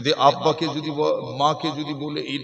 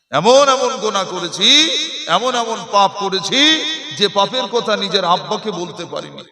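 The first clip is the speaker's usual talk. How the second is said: rising higher and louder line by line; preacher escalating the confession to the crowd, higher and louder; building to a cry; 5 semitones higher, 5 dB louder than usual. Real, not acted. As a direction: climb with each person named. Preacher builds list of sins.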